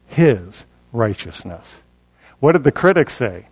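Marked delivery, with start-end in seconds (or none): 0.1-1.8 low, weighty landing after a pause; solemn emphasis. Shown as a demonstration. Preacher naming what God declares, low and solemn. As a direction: drop low and slow, isolating "his".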